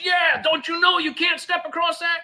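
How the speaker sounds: high-pitched urgent worker voice; anxious warehouse worker. Retold or imitated. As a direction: play the jittery guard warning him off. Worker's voice in retold story.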